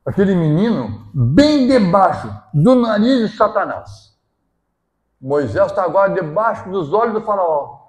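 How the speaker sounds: loud, slow, high preaching; fervent sermon voice; forceful preaching. Acted. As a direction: proclaim it to the congregation.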